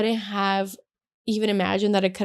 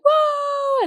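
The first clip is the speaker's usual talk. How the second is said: very high drawn-out exclamation; retold amazed whoa; 4 dB louder than usual. Newly engaged couple reacting to onlookers, high amazed whoa. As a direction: let it leap way up in pitch.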